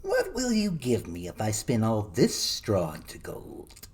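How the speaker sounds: slow, sly imp voice; a slow, coaxing creature voice; little man voice. A narrator's reading. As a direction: bargain slowly like a crafty gnome.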